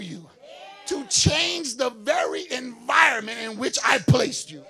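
loud, rising pulpit cry; full-force preaching. Acted. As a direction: preach it out to the church. Preaching the Holy Ghost's call.

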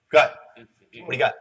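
normal talking voice

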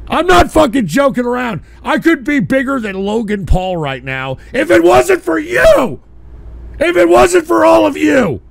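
high, loud rant building to a shout; enraged, wounded yelling; furious blame. Real, not acted. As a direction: blame them all at the top of your voice. Host blames callers for ruining career.

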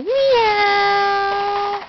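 high, whiny kitten meow; mock cat meow for a baby; playful cat meow. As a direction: cry it like a little kitten. Parent asks what kitty says.

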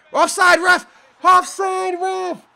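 high, loud appeal; protesting shout. Real, not acted. Gamer appeals to ref for offside, protesting shout. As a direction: call out urgently to the official.

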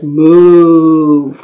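long, low moo; cow's moo. Shown as a demonstration. Storyteller shows what the cow says, long low moo. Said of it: "A cow says".

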